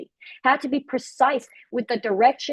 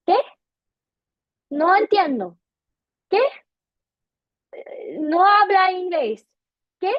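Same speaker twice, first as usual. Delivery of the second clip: confused Spanish speaker; 5 semitones higher than usual. Retold or imitated. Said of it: "they're looking at you saying".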